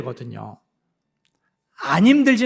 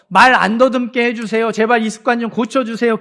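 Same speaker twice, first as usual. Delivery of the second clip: pleading prayer; 5 semitones higher, 5 dB louder than usual. Retold or imitated. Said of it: "기도했어요".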